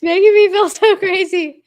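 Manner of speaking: laughing. Real, not acted. Exasperated, laughing at the echo.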